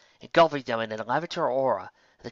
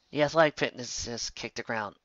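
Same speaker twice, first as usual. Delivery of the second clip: ordinary delivery, nothing marked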